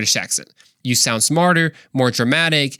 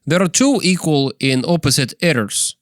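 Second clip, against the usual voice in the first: put-on Russian accent. Shown as a demonstration. Podcaster rereads the quote to be understood, Russian accent. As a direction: read it with a mock Russian accent. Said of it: "in a Russian accent".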